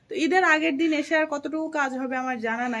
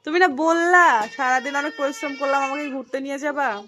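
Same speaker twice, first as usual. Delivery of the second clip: raised, pleading reminder; loud wheedling call; 2 semitones higher, 7 dB louder than usual. Real, not acted. Wife to dozing husband about the outing, loud nag=78. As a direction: rouse him with a loud nag.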